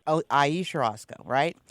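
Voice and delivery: normal talking voice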